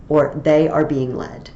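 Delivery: ordinary delivery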